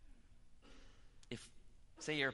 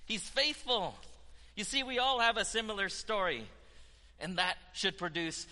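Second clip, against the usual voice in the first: higher, louder rejoicing preacher; preaching; 8 semitones higher, 6 dB louder than usual. Acted. Preacher testifying to the church about God's forgiveness, rising preaching. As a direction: lift it up like good news from the pulpit.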